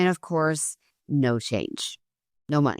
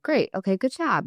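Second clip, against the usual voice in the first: much higher, bright forced-casual praise; cheery outward praise; 7 semitones higher than usual. Retold or imitated. Mom praises son while secretly annoyed, high cheery voice. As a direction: hide annoyance behind upbeat praise. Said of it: "I'm behaving, I'm okay with it".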